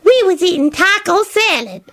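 high-pitched smug woman; high, contented interjection; satisfied. A narrator's reading. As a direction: chime in pleased with yourself. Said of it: "added with satisfaction".

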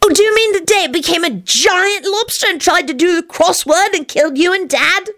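put-on high little-kid voice, working it out; high-pitched childish query; puzzled child. A narrator's reading. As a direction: ask innocently like a small child. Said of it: "responded in a puzzled voice".

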